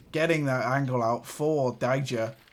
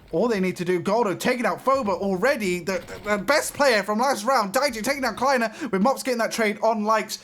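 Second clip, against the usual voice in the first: rising, urgent play-by-play; excited call; 6 semitones higher than usual. Real, not acted. Caster calling fast trades down to one, excited. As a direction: build the tension kill by kill. Caster calls rapid kills.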